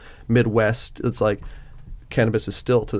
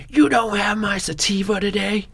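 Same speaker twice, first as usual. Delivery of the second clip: high-pitched outraged customer yell; whine-yell it way up high, hammed up; mock yelling; 9 semitones higher than usual. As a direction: pretend complaint in a much higher voice. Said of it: "like, yelling".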